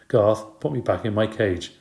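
plain reading voice

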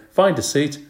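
high, brisk instruction; bus driver's order. A narrator's reading. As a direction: call it out to boarding children.

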